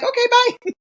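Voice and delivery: high-pitched singsong send-off; mock breezy goodbye. Imitating easygoing friends leaving her to sleep, breezy.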